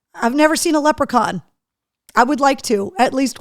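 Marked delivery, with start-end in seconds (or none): none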